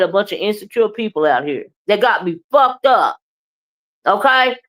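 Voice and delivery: usual speaking voice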